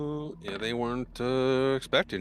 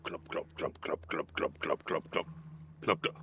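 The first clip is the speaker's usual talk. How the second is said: whispered footstep sounds; 5 semitones lower, 9 dB quieter than usual. Acted. GM imitates heavy footsteps.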